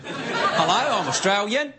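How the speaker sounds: mock Australian accent, ending on a rise; imitating an Australian with a questioning lilt; Australian uptalk. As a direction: turn the Australian introduction into a question. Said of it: "everything goes up at the end".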